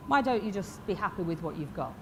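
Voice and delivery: ordinary talk, nothing marked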